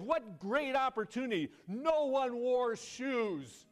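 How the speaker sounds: high, loud, stretched-out jubilation; excited. Acted. Second salesman reporting great opportunity, hugely excited. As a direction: proclaim it like a thrilled salesman. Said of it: "he was excited and he said".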